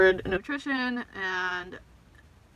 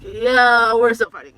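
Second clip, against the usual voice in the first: louder, blunt and exasperated; louder insistence; 2 semitones higher, 13 dB louder, slower than usual. Retold or imitated. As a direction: insist loudly that you're still fighting. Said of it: "And I'm just like".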